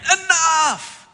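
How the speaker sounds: high, loud exasperated shout; acted parental outburst; fed-up parent. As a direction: snap at bickering kids at full voice. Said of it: "You ever get that way as a parent?".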